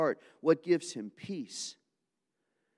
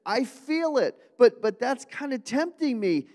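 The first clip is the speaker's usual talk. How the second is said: much higher, louder, slowed, pleading with weeping friends; pained Paul; 10 semitones higher, 9 dB louder than usual. Acted. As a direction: beg them to stop crying, voice aching. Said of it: "He even says".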